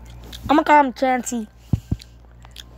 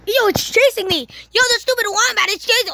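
panicked, higher and fast; scared horror game streamer; 6 semitones higher than usual. Real, not acted. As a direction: yelp it out while fleeing. Fleeing a chasing wombat.

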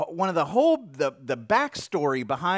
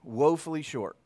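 tender voice of God; 6 semitones lower, 5 dB quieter than usual. Acted. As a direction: speak softly, like God comforting a failing child. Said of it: "God comes and says".

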